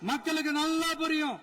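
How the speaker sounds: high-pitched, sustained rally oratory. Real, not acted. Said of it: "கொஞ்சம் சவுண்டா பேசுறேன்".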